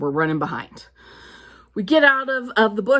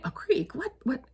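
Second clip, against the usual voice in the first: a quiet, puzzled echo of creek; incredulous question; 8 semitones higher, 8 dB quieter than usual. Retold or imitated. Traveller on a bus tour, baffled by a creek. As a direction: repeat creek as if you can't believe it.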